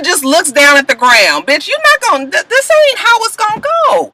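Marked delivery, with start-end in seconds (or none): 1.5-4.1 higher, firm scolding; imagined clapback. Retold or imitated. Vlogger voices what Cynthia should say, higher firm clapback. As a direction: put her in her place.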